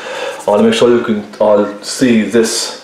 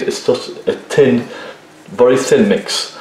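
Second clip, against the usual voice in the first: ordinary delivery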